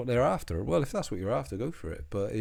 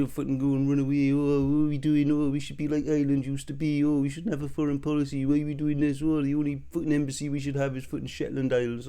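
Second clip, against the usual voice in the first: higher, whining mockery of a coward; mock whiny voice; 7 semitones higher, 3 dB louder than usual. Ranting at his viewers, he mimics their whining cowardice. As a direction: sneer it out as a snivelling coward. Mocking the cowardly listener's thoughts.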